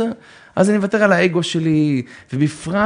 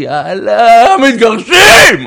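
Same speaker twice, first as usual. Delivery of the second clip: shouted, slow on the last word; mock outburst; 6 semitones higher, 11 dB louder, much slower than usual. Husband storming out on his wife, shouting. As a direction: yell it like you're storming out.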